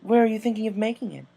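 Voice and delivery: Rarity urging Rainbow to share her idea. Acted. Rarity voiced.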